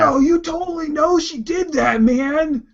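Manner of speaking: very high indignant whine; whiny argument. Acted. Urgek argues with Steve's player, very high whine. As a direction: keep the outraged squeal.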